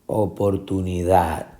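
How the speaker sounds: oportunidad landing on DAD; final stress. Shown as a demonstration. Teacher giving the answer, oportuni-DAD. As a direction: put the weight on DAD in oportunidad. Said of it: "es la sílaba tónica".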